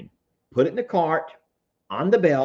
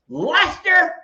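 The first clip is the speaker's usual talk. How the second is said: high, loud mock shout; all-caps voice; 14 semitones higher, 6 dB louder than usual. Streamer calling out commenter Lester, hammed-up shout. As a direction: yell the name in capital letters. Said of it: "so I'm gonna talk in all caps".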